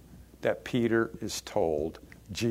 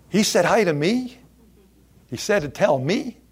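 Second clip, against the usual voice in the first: high and loud, amazed and glad; can hardly believe it, lifted; delighted surprise; 7 semitones higher, 6 dB louder than usual. Acted. Peter hearing Jesus asked for him, high and amazed.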